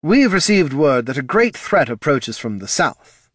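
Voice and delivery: urgent official. A narrator's reading. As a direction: report the threat firmly.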